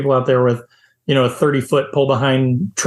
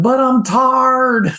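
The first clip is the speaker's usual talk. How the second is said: high, slow, drawn-out whine; a wife whining from the second car, high and slow; whiny tired wife; 9 semitones higher, much slower than usual. Retold or imitated. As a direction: moan it out like a worn-out wife. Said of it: "comes his wife's voice".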